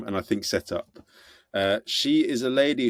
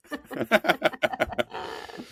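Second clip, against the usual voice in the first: high laugh; 12 semitones higher than usual. Real, not acted. Laughs at the joke.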